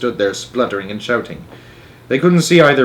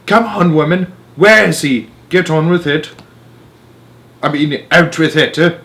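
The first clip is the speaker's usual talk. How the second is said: loud, higher impatient badgering; impatient demand; 5 semitones higher, 4 dB louder than usual. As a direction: push her for the answer. Jenkins demands answer.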